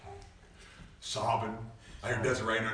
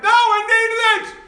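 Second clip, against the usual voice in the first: much higher, louder, slow exclaimed line; emphatic cry; 17 dB louder, much slower than usual. Retold or imitated. Preacher tells his mother he needed the discipline. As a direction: cry it out with conviction. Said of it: "I'm like".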